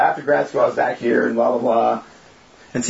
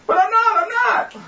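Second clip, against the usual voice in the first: high-pitched protesting whine; whiny protest. Acted. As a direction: argue back like a complaining child. Acted protest to God.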